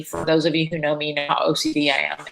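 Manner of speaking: ordinary talk, nothing marked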